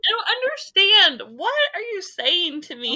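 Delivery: high, loud mock bewilderment; exasperated outburst. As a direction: cry out in exaggerated exasperation. Exasperated reply to husband's innuendo.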